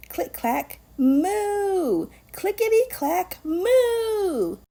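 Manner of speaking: sing-song climb up the scale; rising refrain. Acted. Reader building the typing refrain, pitch climbing.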